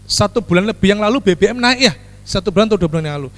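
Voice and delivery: ordinary talk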